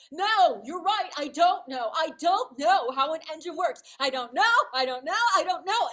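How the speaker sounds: loud, high exasperated confession; retold shout at wit's end, hammering each phrase; weary outburst. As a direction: give up and shout the admission. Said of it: "in a moment of complete weariness".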